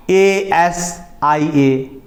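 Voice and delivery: each letter of Asia stretched out, very slow; spelled out slowly. Shown as a demonstration. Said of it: "आपने देख लिया". (धीरे-धीरे spelling)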